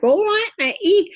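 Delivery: high, loud Cockney call. Retold or imitated. Medium imitating the Cockney boy spirit Mickey, high Cockney call. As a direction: mimic a cheeky Cockney boy. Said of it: "he's very cockney. It's called blimey type".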